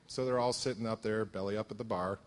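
normal talking voice

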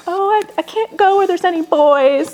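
high, fussy excuse-making; whiny self-mockery. Making excuses to dad about meetings, whiny mock voice. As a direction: whine like you're dodging it, hammed up.

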